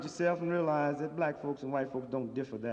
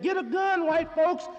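mock rallying cry; 11 semitones higher, 10 dB louder than usual. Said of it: "Paul Revere rode ... and said".

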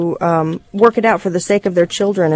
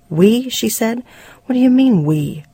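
incredulous challenge; 5 semitones higher than usual. Acted. Wife challenges husband's word "we.

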